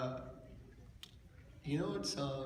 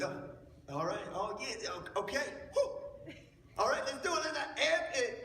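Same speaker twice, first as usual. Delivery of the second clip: pre-line build-up; 9 semitones higher than usual. Shown as a demonstration. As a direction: psych yourself up before the line, then launch. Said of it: "just be like".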